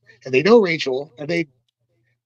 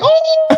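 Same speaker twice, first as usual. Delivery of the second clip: very high, louder cartoon battle cry; mock fighting-game yell; 6 dB louder than usual. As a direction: yelp it like a Street Fighter jump attack. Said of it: "and then he jumped off and went".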